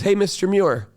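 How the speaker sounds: lightly voiced principal greeting; cheery principal. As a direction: call out like a cheerful boss. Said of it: "He goes".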